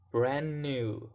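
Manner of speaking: "brand new" run together on one N, no D; linked words. Shown as a demonstration. Teacher shows two words linking, "brand new" with no D. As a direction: drop the D and link "brand new". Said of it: "The two words link together".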